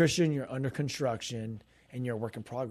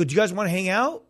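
raised, louder friendly offer; eager invitation; 6 semitones higher, 7 dB louder than usual. Retold or imitated. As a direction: invite them along with energy. Retold eager invitation to the family.

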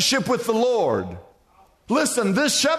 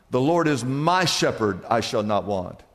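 "my" leaned on in "The Lord is my shepherd"; stress on my; 12 semitones lower than usual. Shown as a demonstration. Preacher to congregation, stressing "my". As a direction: press the word "my" to make it personal. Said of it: "circle the word "my".

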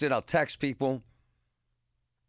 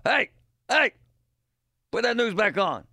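higher, louder grumpy dad bark; woken dad yelling; 8 semitones higher, 4 dB louder than usual. Retold or imitated. Dad woken from his recliner nap, a loud high bark at his son. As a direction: snap awake and demand the news back.

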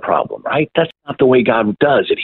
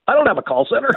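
baffled reply; 10 semitones higher, much faster than usual. Retold or imitated. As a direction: retell a puzzled denial for laughs. Retold baffled reply to caller.

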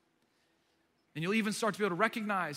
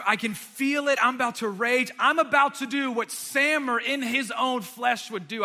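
worked-up retelling; 3 semitones higher, 6 dB louder than usual. Retold or imitated. Preacher retelling nearly raging at the counter, loud and worked up. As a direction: relive the anger boiling up, stumbling over starts. Reliving rising anger at counter.

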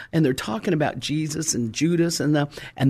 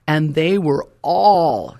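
slow, louder, drawn out all; preacher holding all for a call and response; stretched all; 4 dB louder, much slower than usual. Shown as a demonstration. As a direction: stretch the word all so the church answers. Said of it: "somebody say all".

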